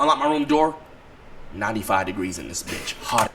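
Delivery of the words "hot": ordinary talk, nothing marked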